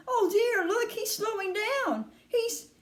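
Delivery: high, anxious, halting; high-pitched mounting concern; worried boy. A narrator's reading. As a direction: watch in alarm, pitch climbing. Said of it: "said Charlie".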